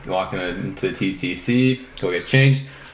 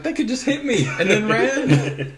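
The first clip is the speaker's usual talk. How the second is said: laughing disbelief; 14 semitones higher, slower than usual. Retold or imitated. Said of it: "I'm like".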